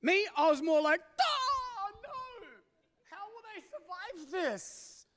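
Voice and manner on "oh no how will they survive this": much higher, whimpering half-sob of a worried dad; mock crying. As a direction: ham up a tearful parent at the school gate. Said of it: "I'm, like, crying".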